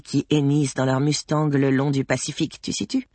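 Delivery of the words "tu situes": high, softer questioning tag; chatty cabin chief. Acted. Cabin chief checking her colleague follows, chatty and high. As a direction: toss in a rising verbal tic.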